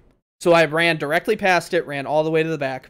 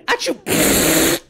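sneeze. Retold or imitated. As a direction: fake a big sneeze. Sneeze sound in story.

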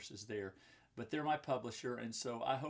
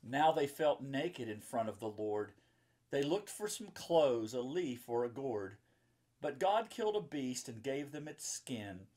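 louder rhyming storybook read; reading to children; 5 dB louder than usual. A narrator's reading. Reading the picture book to the kids watching, louder rhyming read-aloud. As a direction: read it out with a rhythmic lilt.

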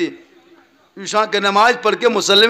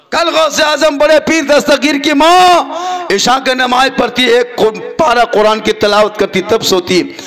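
fervent sermon narration; 4 semitones higher, 7 dB louder than usual. Acted. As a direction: sing out the holy story to the crowd. Preacher tells of the saint's mother. (बुलंद आवाज़ में जोशीला बयान)

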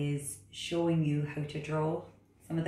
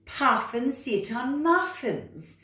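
loud, high, bossy cat voice; 10 semitones higher, 6 dB louder, slower than usual. Acted. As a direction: order the frog about like a know-it-all cat. Cat character.

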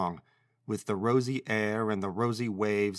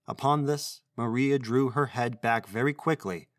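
ordinary delivery, nothing marked

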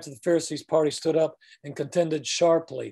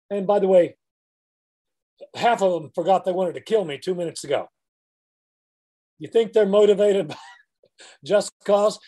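normal talking voice

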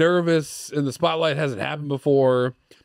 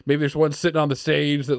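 usual speaking voice

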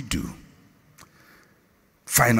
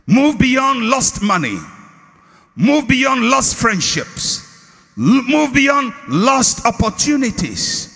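preaching at full force; 9 semitones higher, slower than usual. Acted. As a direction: drive it home to the congregation. Preacher building to a climax.

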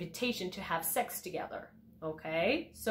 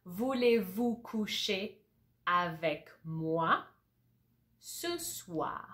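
slowed phrase by phrase; slow repeat; slower than usual. Shown as a demonstration. Teacher slowly repeating the sentence for learners. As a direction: drill it slowly a second time. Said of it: "I'm gonna repeat that slowly".

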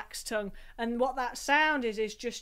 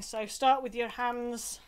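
usual speaking voice

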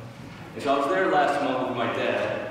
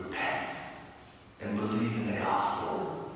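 repent and believe in the gospel said much softer, close and personal; quiet, pointed; 6 semitones lower, 8 dB quieter than usual. Shown as a demonstration. Priest softly tells the congregation to repent, hushed. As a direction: drop to a soft, pointed hush as if speaking to one person. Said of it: "don't take offense".

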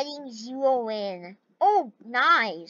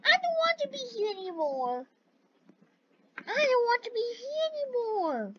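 high, soft crying voice; choking back tears, pleading; tearful; 9 semitones higher, 6 dB quieter than usual. Real, not acted. Game character near elimination crying.

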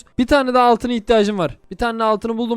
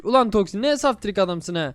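ordinary talk

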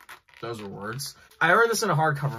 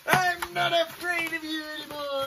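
high voice; 13 semitones higher than usual. Pretend. Mock brave line to box.

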